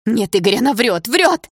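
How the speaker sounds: loud, rising to a high cry; frantic denial. A narrator's reading. Polina telling Igor that Sasha lies, frantic denial. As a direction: insist wildly, pitch climbing on each word.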